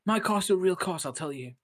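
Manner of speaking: high-pitched, loud mock protest; mock indignant. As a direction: defend it in pretend outrage. Acting out offended student's comeback.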